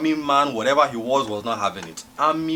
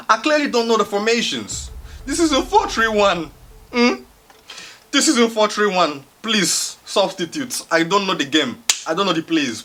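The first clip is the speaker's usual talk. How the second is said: comic pleading; 9 semitones higher, 5 dB louder than usual. Acted. Comic plea to leave the game.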